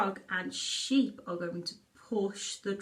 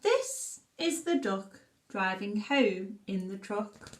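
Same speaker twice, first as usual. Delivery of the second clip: put-on reading a story to children; 2 semitones lower, slower than usual. Reads picture book aloud.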